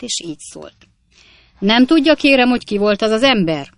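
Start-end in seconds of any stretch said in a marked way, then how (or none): 1.6-3.7 high, loud interrogation; indignant judge. A narrator's reading. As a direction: demand an answer from the witness. Indignant judge questions the witness.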